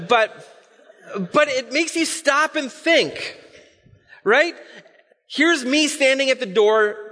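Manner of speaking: ordinary delivery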